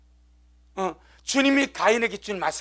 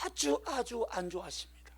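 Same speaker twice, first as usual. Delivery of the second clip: hushed emphasis; 14 dB quieter than usual. Real, not acted. Preacher stresses God's displeasure.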